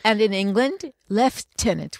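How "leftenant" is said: "lieutenant" said "lef-TEN-unt", slowly; British pronunciation. Shown as a demonstration. Telling colleague how England says it, slow "lef-tenant". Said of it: "with an F".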